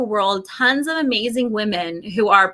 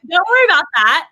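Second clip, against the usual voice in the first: higher, louder cheerful echo; lively don't worry about that; emphatic echo; 6 semitones higher, 6 dB louder, faster than usual. Real, not acted. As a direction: toss it back brightly. Echoing friend, louder and higher.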